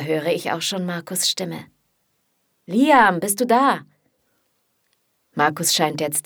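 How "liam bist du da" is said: calling out. Acted. Markus calls through the door.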